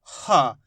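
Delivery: हा pushed out on a breath, falling in pitch; breath-released ha. Shown as a demonstration. Teacher shows learners how to release air, breathy ha. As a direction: let air out of the mouth on हा. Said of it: "मुंह से हवा छोड़ो".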